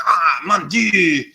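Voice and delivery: slow, hammed-up French "Ah, mon Dieu" with a drawn-out "Dieu"; exaggerated French sigh; mock French accent. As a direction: play a sighing Frenchman. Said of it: "French people would be like".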